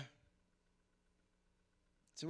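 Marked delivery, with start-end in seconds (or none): none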